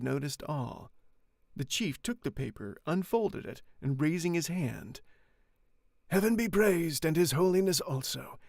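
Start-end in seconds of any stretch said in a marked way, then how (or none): 6.1-8.4 loud proclamation. Acted. The friar announcing the pardon loudly to the crowd. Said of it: "he said in a loud voice".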